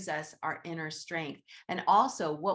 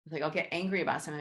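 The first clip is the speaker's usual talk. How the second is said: usual speaking voice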